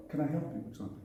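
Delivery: polite offer of help. Shown as a demonstration. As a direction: ask gently how you can help. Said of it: "I said".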